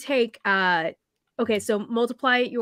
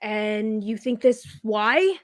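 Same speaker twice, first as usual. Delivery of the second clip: slow, doubting question; slow skeptical challenge; skeptical dwarf; much slower than usual. Acted. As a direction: drag it out, unconvinced. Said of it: "folds his arms and is like".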